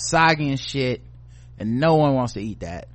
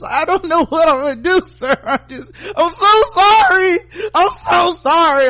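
mock sobbing apology; 8 dB louder than usual. Imitating a phone agent sobbing apologies to a caller, high and loud. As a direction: wail an over-the-top apology. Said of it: "And it's".

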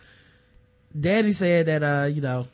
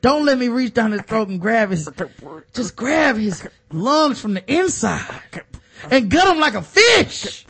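intense prayer; 3 semitones higher, 4 dB louder than usual. Pretend. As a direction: build the prayer to a roar. Mock Ray Lewis prayer.

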